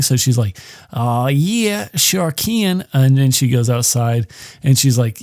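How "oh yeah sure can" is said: higher, put-on Australian woman; Australian accent. Woman answering her blind date by phone, mock Australian accent. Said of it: "she's using her, uh, Australian accent".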